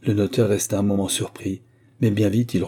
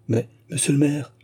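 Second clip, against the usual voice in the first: a higher-pitched, understated cry of protest; mild exclamation; 6 semitones higher than usual. A narrator's reading. Hélène protests to the departing mayor, mildly exclaiming. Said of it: "s'écria Hélène".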